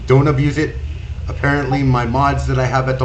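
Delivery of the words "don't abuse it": usual speaking voice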